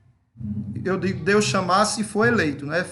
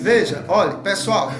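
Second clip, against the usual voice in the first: raised voice over the chatter; calling for attention; 6 dB louder than usual. Real, not acted. Leader to a laughing meeting, calling for attention. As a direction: call the group back to order, loudly. Calls laughing group back to order.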